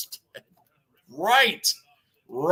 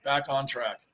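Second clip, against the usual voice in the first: a soft, low afterthought echo; muttered echo; 5 semitones lower, 6 dB quieter than usual. Real, not acted. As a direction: lower and softer, said to himself. Echoes himself, dropping away.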